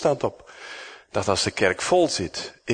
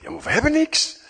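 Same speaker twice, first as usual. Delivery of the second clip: whiny disciples; faster than usual. Retold or imitated. Disciples' reply voiced high.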